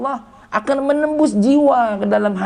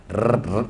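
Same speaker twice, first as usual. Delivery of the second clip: low rolled-r vibration noise; short low buzzing bursts; imitated phone buzz; 10 semitones lower, 5 dB quieter than usual. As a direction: trill "rrrt" like a vibrating phone. Imitates phone buzzing during study.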